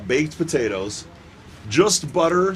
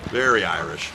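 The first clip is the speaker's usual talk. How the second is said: "Very Irish" dropped lower in a put-on voice; mock deep echo; 7 semitones lower than usual. Shown as a demonstration. Commentator echoing his own joke in a lower put-on voice.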